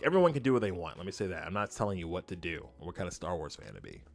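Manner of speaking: ordinary talk, nothing marked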